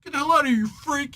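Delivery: high, loud acted outburst; mock-angry shout at a stranger; hostile yell. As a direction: yell it like a kid driving off a freak. Acts kid yelling at Silver Surfer.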